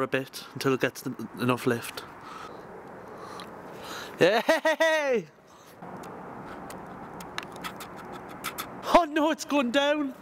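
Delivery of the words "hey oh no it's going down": excited alarm. Real, not acted. Lantern falling.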